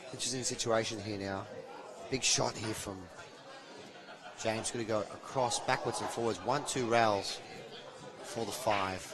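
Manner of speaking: soft, low commentary during the shot; hushed play-by-play. Real, not acted. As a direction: keep it down while the player shoots.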